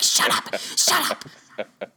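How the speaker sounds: half-hearted mock snap at her children; Kim Davis impression. Mocking the clerk snapping back at her kids, hammed-up. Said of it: "That's my impression of Kim Davis".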